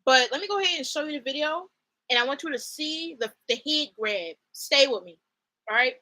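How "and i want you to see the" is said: ordinary talk, nothing marked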